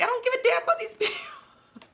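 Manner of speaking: high, laughing mock outburst; retold loud outburst. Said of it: "I'm kinda a little loud".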